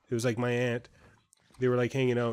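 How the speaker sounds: ordinary talk, nothing marked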